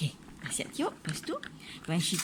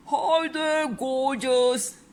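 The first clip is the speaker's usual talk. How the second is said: loud, higher call across the water; shouted greeting; 6 semitones higher, 7 dB louder, slower than usual. A narrator's reading. Windsurfer speeding past calls out to Missus Armitage, loud. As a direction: call out cheekily to her. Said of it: "he shouted".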